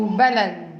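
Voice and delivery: بلن with the l and b of لبن swapped; reversed sounds. Shown as a demonstration. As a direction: say بلن, b first and l second. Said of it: "أو كده عكس".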